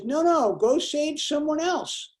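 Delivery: old man refusing the helicopter, lightly voiced; stubborn old man. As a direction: wave them off, slowly and sure. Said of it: "The old man says".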